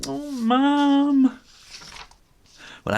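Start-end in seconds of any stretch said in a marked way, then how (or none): 0.1-1.4 very high, loud embarrassed whine; whiny kitten. A narrator's reading. Ginger groaning at mum at the school gate, high and loud. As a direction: protest like a mortified kid. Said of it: "He's going".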